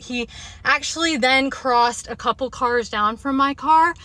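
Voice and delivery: ordinary delivery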